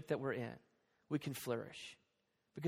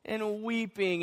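ordinary talk, nothing marked

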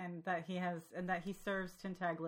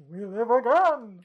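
Keith David voice; 5 semitones higher, 15 dB louder, slower than usual. Retold or imitated. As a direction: boom it out like a gargoyle. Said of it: "Keith David's".

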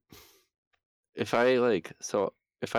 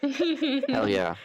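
excited; 3 dB louder than usual. Acted. Player cheers in high voice.

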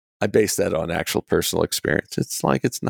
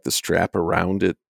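ordinary talk

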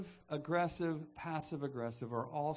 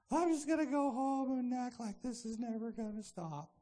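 hammed-up self-pity, pitched way up; whining passive victim; 7 semitones higher than usual. Victim insisting nobody understands, high exaggerated whine. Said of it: "that passive victim".

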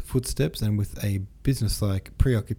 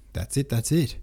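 higher, lightly excited exclamation; exclaiming; 5 semitones higher than usual. A narrator's reading. Count agreeing with the cook, exclaiming. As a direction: exclaim it with a lift in pitch. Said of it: "exclaimed the Count".

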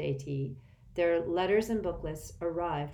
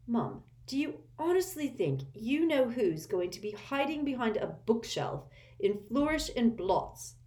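higher mocking teen; scoffing at his mother; sniggering; 6 semitones higher than usual. A narrator's reading. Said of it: "sniggered Ron".

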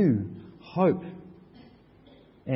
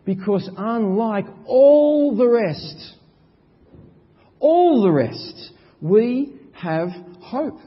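higher, louder and slow, landing on hope; full-force preaching; 9 semitones higher, 7 dB louder, much slower than usual. Acted. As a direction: proclaim it with triumph.

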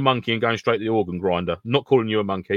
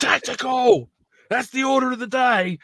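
mock tactical shout; slower than usual. Mock military call-out.

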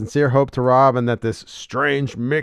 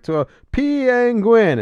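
much higher, slow character voice; high, slow quoted reply; 12 semitones higher, 5 dB louder, slower than usual. Retold or imitated. Man's answer, quoted.

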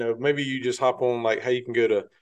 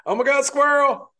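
high squeal at a squirrel; sudden excited shriek; surprised squeal; 13 semitones higher, 4 dB louder than usual. Real, not acted.

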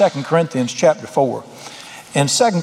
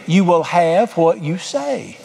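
slow weighty quote; much slower than usual. Acted. Preacher quoting Mark eleven slowly.

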